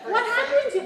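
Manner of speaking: high, loud alarmed parent gasping at a report card; shocked parent. Retold or imitated. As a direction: play the horrified parent, a laugh in it. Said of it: "Do we go".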